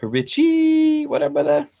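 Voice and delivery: very high, drawn-out name call; delighted high-pitched shout-out; excited greeting. Real, not acted. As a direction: squeal a friend's name in hello. Greets friend joining the stream.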